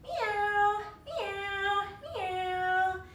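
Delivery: high meows, the last one sung; singsong cat meows. Shown as a demonstration. Teacher meowing in singsong for the kids. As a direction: meow like a kitty, sing the last. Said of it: "look back at your belly button".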